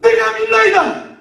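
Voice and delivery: high, loud, drawn-out declaration; shouted cry. Acted. Father crying out his belief to Jesus, shouting. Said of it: "아버지가 소리를 질러 그러는데".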